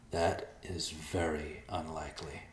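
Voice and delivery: reply quietly and slowly; calm and quiet. Acted.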